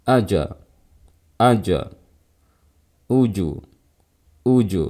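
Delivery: "Aja, uju" with the bounce coloured by the preceding vowel; wrong vowel-tinted qalqalah. Shown as a demonstration. Teacher warning students against mixing vowels in, faulty qalqalah in "aja, uju". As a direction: let the j release echo the first vowel in "aja", "uju". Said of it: "Jangan kayak gini".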